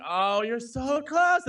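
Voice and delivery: drawn out high and loud, willing him on; slow, loud, rising plea as if cheering at the screen; mock anguish. Retelling the Spider-Man scene, a slow high loud plea. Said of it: "and I'm like".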